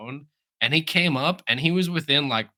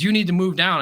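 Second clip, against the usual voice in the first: louder, higher mock yell; retold yelling; 5 semitones higher, 5 dB louder than usual. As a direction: imitate a guard yelling an order. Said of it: "He kept yelling about it".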